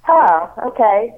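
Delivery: muffled Bluetooth headset sound; speaking through a bad headset; headset audio. Real, not acted. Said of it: "it doesn't sound good".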